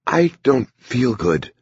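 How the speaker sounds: weak and slow, unwell; frail. A narrator's reading. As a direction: speak it faintly like a sick man. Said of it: "the Foki sounded frail".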